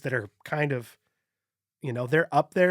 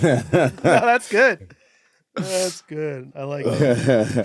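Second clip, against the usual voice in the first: laughing; 9 dB louder, much slower than usual. Real, not acted. Playful reply to weird voice.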